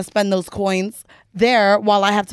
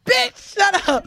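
laughing protest; 8 semitones higher, slower than usual. Real, not acted. Protesting the impersonation.

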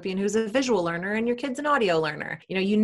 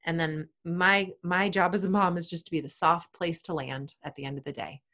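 normal talking voice

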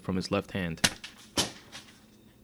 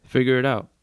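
louder, higher brush-off; curt dismissal; 5 semitones higher, 7 dB louder than usual. A narrator's reading. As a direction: shut the girl down forcefully. Miss Walker dismisses the girl's question.

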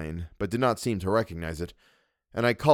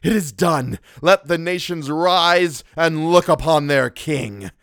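high, loud, triumphant proclamation; grand, ecstatic shout; exultant cry; 7 semitones higher, 7 dB louder, slower than usual. A narrator's reading. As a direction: proclaim it to the nations.

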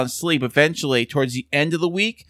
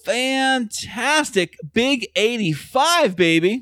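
excited; 9 semitones higher, much slower than usual. Acted. Hyping the episode number.

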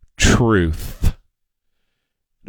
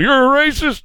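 mocking accuser; 11 semitones higher than usual.

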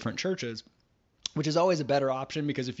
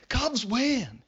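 higher, jubilant cry of victory; excited cheer; 9 semitones higher than usual. Real, not acted. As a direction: shout it like the final out just landed. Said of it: "Cubs win, baby".

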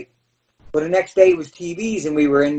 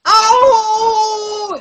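much higher, louder yelp of agony; loud fake cry of pain; 5 dB louder than usual. Selling a ball shot in the ring, loud mock cry. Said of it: "as loud as I could".

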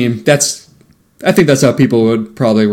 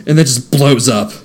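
ordinary talk

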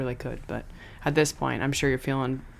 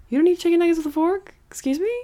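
mock outrage; 11 semitones higher, faster than usual. Mock outrage over forks.

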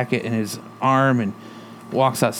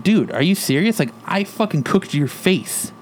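mocking Negan; 5 semitones higher than usual. Negan taunts Mark about his burned face, lightly imitated, incredulous. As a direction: sneer it up at him like Negan, can't believe him. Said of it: "He's like".

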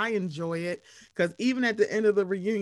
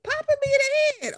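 much higher and fast, put-on whiny voice; aggrieved squeak; 4 dB louder, faster than usual. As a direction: rattle it off in a high complaining squeak. Mime complaining about purse hit.

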